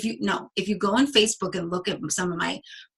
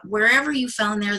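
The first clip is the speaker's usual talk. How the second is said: ordinary delivery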